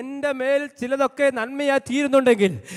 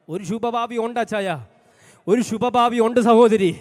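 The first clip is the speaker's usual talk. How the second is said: ordinary talk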